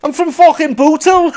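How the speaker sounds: high-pitched fake Scouse with a laugh; badly faked Scouse; mock Scouse accent. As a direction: overdo the Liverpool accent. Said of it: "that accent was proper abysmal".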